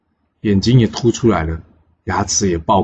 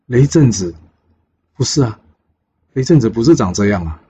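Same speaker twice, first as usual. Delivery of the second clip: astonished disbelief; 3 semitones higher than usual. Acted. Father doubting this is his son, astonished. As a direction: question the name in mild mock shock.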